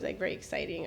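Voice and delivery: ordinary delivery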